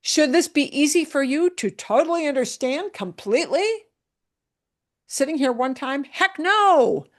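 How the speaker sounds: high, loud mock disbelief building to a cry; playful teacher exclaiming heck no; rhetorical heck no. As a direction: answer your own silly question with a bright loud no. Teacher mock-asks then answers herself.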